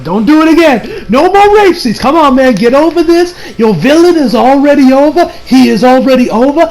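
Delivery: high, insistent pleading rant; fed-up fan telling off a writer; exasperated scolding. Pretend. Scolding the writer about repetition.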